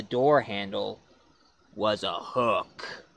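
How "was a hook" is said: spooky reveal. Acted. Reveal of the hook, slow.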